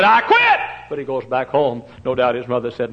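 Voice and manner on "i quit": high, loud cry of giving up; shouted quitting. Retold or imitated. Preacher voicing John Mark, shouting that he quits. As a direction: yell it like a scared boy throwing in the towel.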